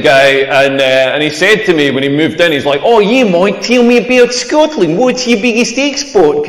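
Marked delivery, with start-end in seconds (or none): 2.8-6.5 exaggerated Australian flatmate; Australian accent. Australian flatmate asking the comedian about Scotland, mock Australian accent. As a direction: put on a broad, comic Australian accent. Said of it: "that's exactly what Australians sound like".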